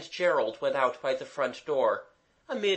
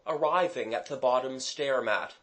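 ordinary delivery, nothing marked

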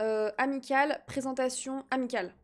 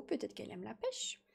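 soft whispered aside; whispering; 4 semitones lower, 11 dB quieter than usual. Real, not acted. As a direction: drop to a whisper. Whispered aside during stream.

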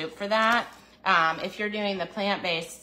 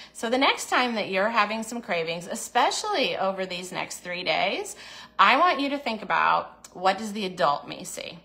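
usual speaking voice

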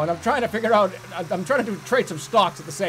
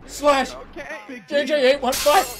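loud, higher shouted plea; calling out; 7 semitones higher, 5 dB louder than usual. Real, not acted. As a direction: yell it across the room. Calling out for a Band-Aid.

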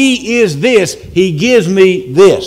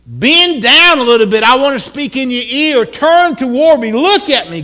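raised, insistent pleading; desperate plea; 6 semitones higher than usual. Acted. David begging God to listen, desperate plea. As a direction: plead urgently for attention. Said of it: "David is in desperate need".

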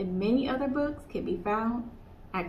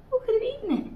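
much higher, wondering aloud; puzzled question; much faster than usual. Acted. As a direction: squeak it out, puzzled. Kanga puzzled, squeaky question.

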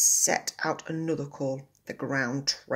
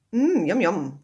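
lip-smacking yum; 4 semitones higher, 8 dB louder than usual. A narrator's reading. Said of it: "he licked his lips".

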